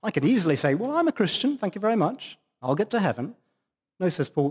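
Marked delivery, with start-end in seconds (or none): 0.7-3.3 higher-pitched, breezy mock complacency; glib self-assurance. Preacher imitating someone just mouthing faith, glib and self-assured. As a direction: imitate someone blithely sure of themselves.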